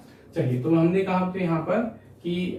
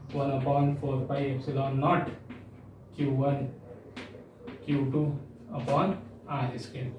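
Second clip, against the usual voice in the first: slow dictation; 3 semitones lower, 6 dB quieter, much slower than usual. Shown as a demonstration. Teacher dictating a formula.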